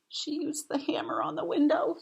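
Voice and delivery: whispered narration; a secret little whisper; hushed aside. A narrator's reading.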